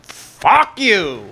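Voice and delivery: imitating the cop in Scarface; cop's defiant line. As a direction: spit it as the dying cop. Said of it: "then the cop goes".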